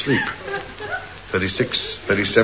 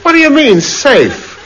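high, loud disbelief; can't believe what he heard; incredulous; 6 dB louder than usual. Acted. Incredulous at the policeman.

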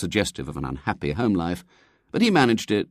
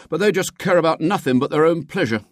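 put-on higher-pitched complaining boy; a boy warming to his grievance; aggrieved schoolboy; 10 semitones higher, 4 dB louder than usual. A narrator's reading. William complains to the lady about family, aggrieved.

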